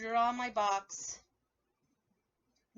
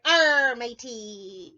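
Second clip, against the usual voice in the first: hammed-up pirate growl, loud; a quick loud pirate arr; 9 dB louder, much slower than usual. Teacher playing pirate for the kids, loud arr. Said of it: "pretending to be a pirate".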